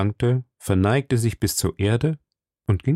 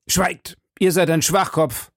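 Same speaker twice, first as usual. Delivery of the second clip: higher, cutting rebuke; angry cry; 7 semitones higher than usual. A narrator's reading. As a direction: silence him with a sharp cry. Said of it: "rief der Kardinal".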